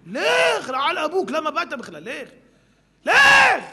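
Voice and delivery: high, loud furious dismissal; angry shout. Retold or imitated. Rabbi throws the rude guest out, shouting. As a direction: drive him out with a yell. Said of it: "אמרתי לו".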